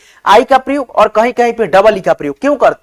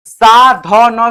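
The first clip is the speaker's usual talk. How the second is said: slow spelled out; 5 dB louder, much slower than usual. Shown as a demonstration. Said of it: "एस ए से सा".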